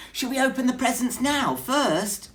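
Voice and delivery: high, fast, loud squeaky question; tiny mouse voice bubbling with eagerness; eager mouse. Acted. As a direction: rush it out like an eager little mouse. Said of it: "Mouse asked eagerly".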